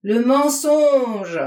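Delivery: slow bellowed accusation; howled at full force. A narrator's reading. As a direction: stretch it into a roar. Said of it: "il hurla du plus fort qu'il pouvait".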